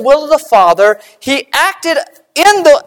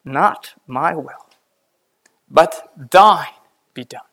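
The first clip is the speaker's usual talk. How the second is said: slow, lower, much softer prayer of surrender; a hushed, slow, lower Gethsemane prayer; 9 semitones lower, 4 dB quieter, slower than usual. Retold or imitated. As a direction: yield your will in a hushed, slow voice.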